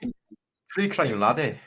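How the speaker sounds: playful put-on Scottish accent. Joke intro for a true crime blog, Scottish accent. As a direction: announce it as a jokey Scot. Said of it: "start off with a Scottish accent".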